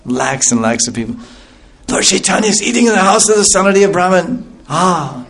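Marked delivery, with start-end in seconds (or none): 1.9-5.2 high, eager crowd chatter; a hint of an excited villager; excited townsperson. Townsperson telling others the Lord is eating nearby, excited.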